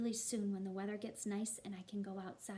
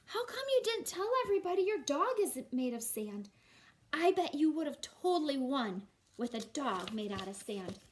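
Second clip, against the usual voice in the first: put-on higher, louder eager little boy voice; Matthew the boy; 4 semitones higher, 7 dB louder than usual. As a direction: play the curious kid asking his friend. Boy Matthew speaks in story.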